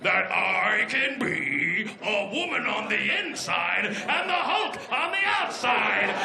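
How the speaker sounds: very slow, strange put-on voice, building to a shout; impression of her. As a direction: drag out every word in a hammed-up bodybuilder voice. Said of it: "do an impression of her".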